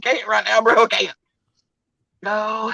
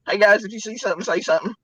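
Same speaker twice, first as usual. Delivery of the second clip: ordinary talk, nothing marked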